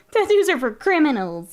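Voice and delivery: mock-serious sarcasm, high and loud; sarcastic. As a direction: say it with heavy sarcasm.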